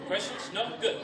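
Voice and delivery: ordinary delivery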